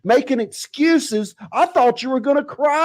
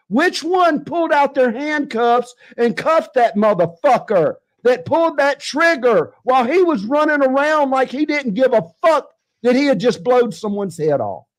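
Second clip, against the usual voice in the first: ordinary delivery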